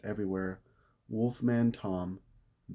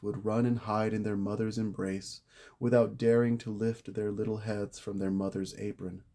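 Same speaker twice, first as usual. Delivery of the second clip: usual speaking voice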